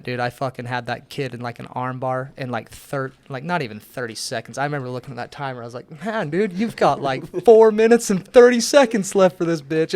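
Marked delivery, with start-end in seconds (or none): 6.0-9.9 high, loud gleeful taunting; raised voice, rubbing it in. Retold or imitated. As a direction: crow it at a trapped opponent, laughing. Acted taunt of a trapped opponent.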